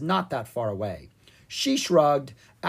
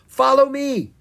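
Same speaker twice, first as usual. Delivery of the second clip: high, loud rallying shout; calling out; 12 semitones higher, 8 dB louder than usual. A narrator's reading. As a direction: shout for him to come along. Calling brother during the chase.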